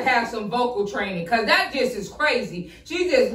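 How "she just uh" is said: winded after trying the beat; breathless, gasping between words; out of breath. Real, not acted. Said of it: "I'm out of breath just doing it halfway".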